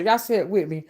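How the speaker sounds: usual speaking voice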